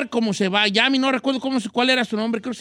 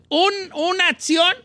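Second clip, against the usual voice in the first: emphatic call out; 7 semitones higher than usual. Real, not acted. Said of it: "host emphasizing point".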